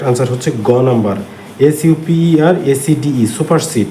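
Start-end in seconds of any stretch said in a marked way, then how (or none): 1.6-3.3 slow letter-by-letter spelling; teacher slowly spelling a word. Shown as a demonstration.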